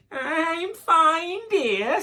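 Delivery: high, sugary wolf pretending to be Grandma; sweet wolf. The wolf fooling Little Red, sickly sweet and high. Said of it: "said the wolf sweetly".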